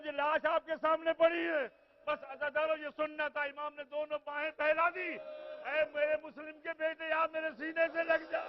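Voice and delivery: full-force majlis wailing; preaching lament. Acted. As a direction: mourn it aloud to the gathering. Preacher wails the scene.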